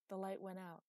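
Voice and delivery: ordinary delivery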